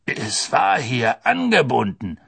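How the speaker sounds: slow, seething protest; furious executioner, measured delivery; enraged executioner. A narrator's reading. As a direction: insist slowly, full of anger. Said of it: "sagte der Henker wutentbrannt".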